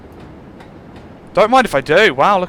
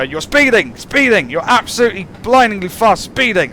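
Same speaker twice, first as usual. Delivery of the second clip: higher, louder mock warning yell; game shouting at me; 7 semitones higher, 4 dB louder than usual. The game yells at the speeding driver, mock shout. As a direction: bark it like an alarm nagging the driver. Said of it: "I need the game to just shout at me".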